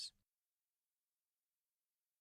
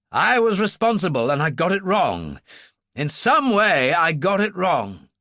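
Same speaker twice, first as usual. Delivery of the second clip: raised, emphatic self-blame; vehement self-reproach; 4 semitones higher, 3 dB louder than usual. Acted. Ferguson admits his failure to the senator, self-reproachful. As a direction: blame yourself hard, pitched up.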